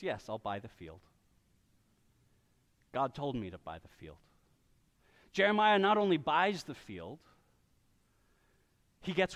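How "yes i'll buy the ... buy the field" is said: low, soft, settled yes; quiet resolve. Retold or imitated. Preacher voices Jeremiah softly agreeing to buy. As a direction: answer calmly and lower the voice. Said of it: "Jeremiah says".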